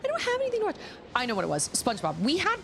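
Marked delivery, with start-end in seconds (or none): 0.0-0.8 put-on high whiny child complaining; whiny kid voice. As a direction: whine like a bored kid. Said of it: "Charlotte was like".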